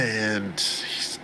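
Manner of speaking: usual speaking voice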